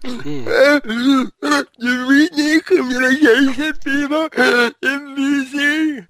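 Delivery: high, slow mocking impression of her; mock her in a high, dragged voice; impression of Naomi Yamamoto. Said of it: "here's my impersonation of her".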